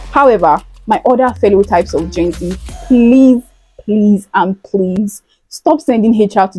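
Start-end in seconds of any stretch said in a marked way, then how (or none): none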